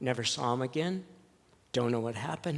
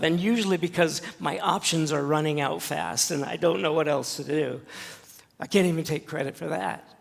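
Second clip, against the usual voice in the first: louder, higher, rising preacher's fervor; preaching at full force; 4 semitones higher, 7 dB louder than usual. Real, not acted. Pastor urging his church to surrender, in full fervent preaching. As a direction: proclaim it to the congregation, swelling.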